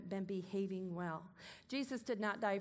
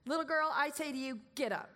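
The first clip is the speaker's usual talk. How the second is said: put-on firm raised command to the girl; commanding call; 5 semitones higher, 4 dB louder, faster than usual. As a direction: speak it like a healing order. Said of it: "Command it".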